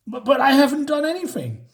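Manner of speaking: high-pitched, stammering mock panic; a suspect protesting his innocence to police; panicked protest. High-pitched panic.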